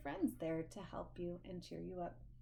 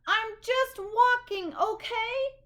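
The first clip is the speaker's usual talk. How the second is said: high, loud and slow, stretched out; fed-up bird shouting slowly; angry shout; 12 dB louder, slower than usual. Acted. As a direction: hammer each word at the fox.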